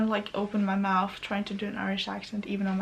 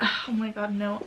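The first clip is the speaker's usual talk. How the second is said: ordinary delivery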